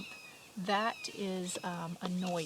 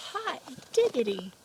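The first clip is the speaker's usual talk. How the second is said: loud surprised burst; exclaiming; 6 semitones higher, 8 dB louder than usual. Acted. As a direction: blurt it out in sudden delight. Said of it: "exclaimed Mole".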